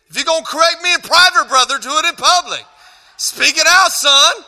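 high, loud and fast mock telling-off; mock scolding. Speaker calls out whoever corrected him in private, mock scolding. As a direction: call the heckler out, playfully indignant.

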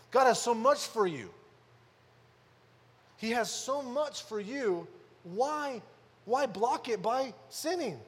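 preacher reading scripture aloud with force; preaching scripture reading. Acted. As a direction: proclaim the verse to the congregation. Preaching builds again.

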